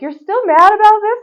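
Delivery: a playful take on her husband's teasing; imitating Eric. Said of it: "Eric's like".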